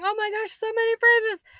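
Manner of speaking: high, loud mock dismay; hammed-up overwhelmed child; mock overwhelmed kid. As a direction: play a swamped pupil groaning at the list. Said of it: "you're looking at this going".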